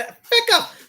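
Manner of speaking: high-pitched comic catchphrase, drawn up and falling away; Mrs Brown. Retold or imitated. Host mimicking a favourite sitcom, high comic catchphrase. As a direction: squawk it high like a sitcom character. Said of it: "Missus Brown's boys".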